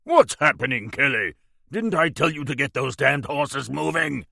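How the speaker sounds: irate shout from the coach window; angry. Acted. Marley yelling at his coachman to move the horses, angrily. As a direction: snap at the driver for stalling. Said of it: "said angrily".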